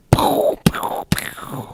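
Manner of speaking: whispered laser-shot sounds; airy, voiceless pew effects; pew noises. Pretend. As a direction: make little blaster noises. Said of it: "on the bottom it says".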